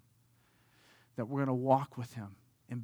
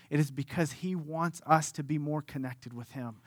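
ordinary delivery

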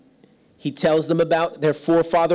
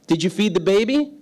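voicing the wife; 6 semitones higher than usual. Wife voiced in story.

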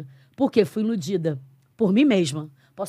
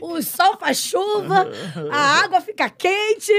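retold prayer; 9 semitones higher, 6 dB louder than usual. A woman promising God, high, loud, pleading. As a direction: cry out begging the Lord high and loud. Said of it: "Eu falei".

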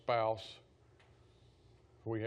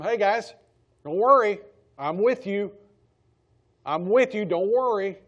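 louder and higher, calling across the storm; raised voice, calling to the disciples over a storm; 7 semitones higher, 11 dB louder than usual. Retold or imitated. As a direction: shout reassurance to frightened friends. Jesus cries out to them.

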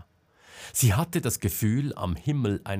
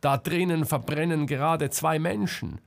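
desperate; 6 semitones higher than usual. A narrator's reading. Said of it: "sagte Selma verzweifelt".

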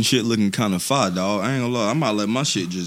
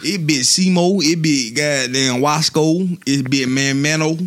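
gravelly hype-man intro, drawn out; wrestling promo; slower than usual. Pretend. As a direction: cut a promo like a pro wrestler.

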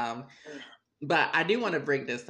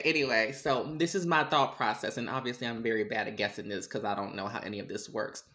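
normal talking voice

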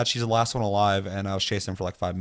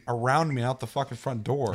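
usual speaking voice

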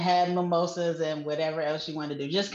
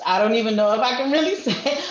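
laughing; 3 semitones higher, 6 dB louder, faster than usual. Real, not acted. Laughing while admitting reluctance.

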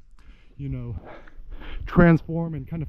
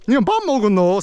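high, twangy Busan dialect; Busan uncle intonation; 6 semitones higher, 3 dB louder, much faster than usual. Acted. Uncle asking nephew about eating, twangy Busan accent. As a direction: swing the pitch like a Busan uncle. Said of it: "it's kinda like this".

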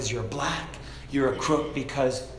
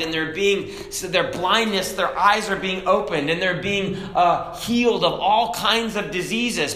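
raised, high, loud preaching; fiery preaching; 6 semitones higher, 4 dB louder than usual. Acted. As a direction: preach it hard to the congregation. Preaching at full force.

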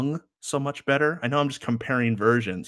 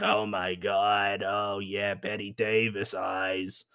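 slow, drawn-out smoker impression; 2 semitones lower, slower than usual. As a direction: croon it slowly like a chain smoker. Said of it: "sounds like a smoker got a hold of a microphone".

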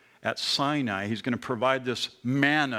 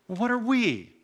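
pitched-up what are we; incredulous high question; 9 semitones higher than usual. Retold or imitated. Preacher voicing Moses, high and incredulous. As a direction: echo Moses in disbelief.

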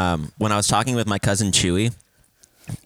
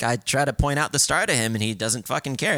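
ordinary delivery

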